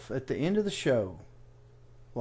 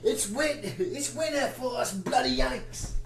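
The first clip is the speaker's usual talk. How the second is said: high, softer mock Aussie voice; bad Australian accent; 11 semitones higher, 5 dB quieter than usual. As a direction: badly fake an Australian, pitched up high. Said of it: "That's a horrible accent".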